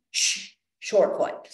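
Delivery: slow hissed shh then short foot; hissed cue. Shown as a demonstration. Coach demos landing, hissed exhale cue. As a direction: hiss then cue slowly. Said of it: "I'll start by kind of doing this".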